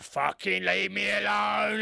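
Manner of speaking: drawn-out mock yell; slow, stretched holler, imitating a man fending off help; imitated drunken shout. Drunk chairman to directors picking him up, drawn-out mock shout. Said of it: "like that".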